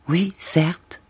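breathy hushed whisper; soft whisper to herself; whispered aside. A narrator's reading. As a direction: whisper it as a private decision. Inner resolve, hushed aside.

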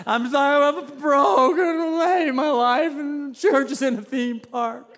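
high, slow, crying-voiced confession; retold sobbing at lunch, high and slow; mock bawling. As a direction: blubber through the list. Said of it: "I just start bawling".

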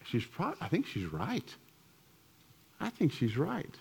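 a hushed, reluctant concession; quiet realization. Retold or imitated. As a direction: let it sink in quietly. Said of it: "and I was like".

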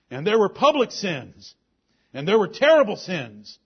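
loud, high, hammering preacher's cadence; fiery preaching. Acted. Preacher exhorting his church, loud and driving. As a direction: preach it to the congregation full force.

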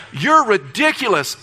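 high, loud, drawn-out indignation; acted outrage, slow and emphatic; indignant. Pastor thinking about Gary's luck, indignant. He inwardly rails at Gary.

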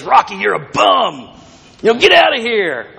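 a mild stab at Mickey's Hollywood trainer voice; half-hearted impression of the old trainer yelling; Mickey's gruff accent. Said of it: "I wish I could do Mickey's accent".

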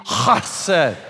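"hesed" with a long rasped guttural H; coughed-up "hesed". Shown as a demonstration. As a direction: hack out the H of "hesed" from the throat. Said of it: "you're coughing something up and just say".

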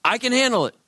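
high, loud, boastful insistence; cocky self-assurance. Pretend. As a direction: brag that you have it all under control. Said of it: "thinking to yourself".

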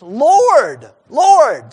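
urgent cry. Retold or imitated. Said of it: "Matthew has".

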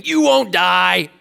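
overbearing emphatic statement; intimidating. Acted. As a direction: trump them with a forceful, drawn-out denial. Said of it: "like, intimidating".

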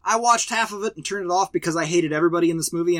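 ordinary delivery

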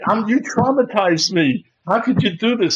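high-pitched mock outrage; traumatized protest. Birthday man blaming his laughing friend, traumatized protest. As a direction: protest as if scarred for life. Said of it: "I'm traumatized by this".

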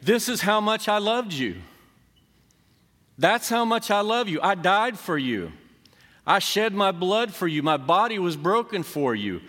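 loud and high, proclaiming as God; preacher booming out God's own words. Acted. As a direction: declare God's love with full force. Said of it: "the voice of God saying".

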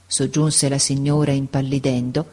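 ordinary delivery

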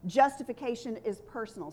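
usual speaking voice

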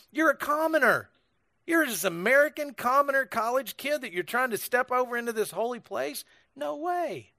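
high-pitched, indignant imitation of a scolding woman; mock angry. Angry church woman rebukes an American college kid, mock indignant. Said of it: "she was angry".

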